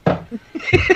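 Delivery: airy, unvoiced chuckle; breathy laugh. Real, not acted. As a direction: laugh silently at yourself. Laughs at soap taste.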